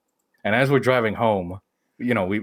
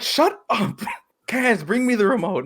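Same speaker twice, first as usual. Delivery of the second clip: high-pitched snapping at the son; fed up, bark it out high; mock angry order; 11 semitones higher than usual. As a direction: mom turning on her son for the remote, high and cross. Mom fed up, orders her son.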